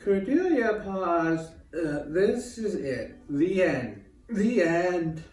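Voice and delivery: slow, weighty reading of the story's climax; dramatic story reading. A narrator's reading. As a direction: draw each word out like a final curtain. Reads own story dramatically.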